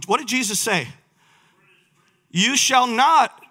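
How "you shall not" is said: loud, high, slow drawn-out command; emphatic, raised-voice refusal; forceful rebuke. Acted. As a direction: refuse the tempter with full preaching force. Jesus rebuking the devil, preacher acting it.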